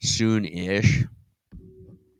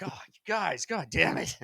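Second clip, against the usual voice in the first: high, softer mock exasperation; lightly acted dismay, pitched up; exasperated God; 5 semitones higher, 7 dB quieter than usual. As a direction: sigh it out as a let-down God. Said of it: "It's like".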